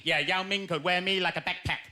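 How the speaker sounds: usual speaking voice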